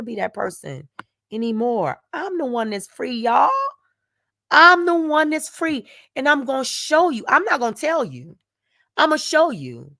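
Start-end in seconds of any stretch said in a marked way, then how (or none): 4.5-5.9 higher and louder, proclaiming it; louder declaration. Real, not acted. Telling her viewers she is free, raised and loud. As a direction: announce your freedom to the viewers.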